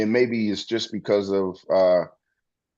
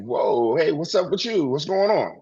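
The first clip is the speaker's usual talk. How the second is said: mock surprised greeting; 7 semitones higher than usual. Imitated greeting to someone.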